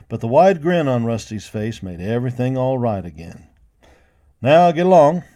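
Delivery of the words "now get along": loud, high command; ordering. A narrator's reading. Boss ordering cowboys back to work, loud command. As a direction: send them off with a firm loud order. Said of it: "the boss ordered".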